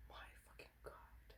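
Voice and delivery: breathless whispered swear in awe; hushed, stunned whisper; awed whisper. Real, not acted. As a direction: whisper it, overwhelmed by the palette. Awe at the expensive palette.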